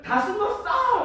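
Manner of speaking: high, drawn-out hide-and-seek call; singing out slowly in a high pitch; child calling out. Retold or imitated. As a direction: imitate a little kid calling from hiding. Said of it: "라고 외쳐요".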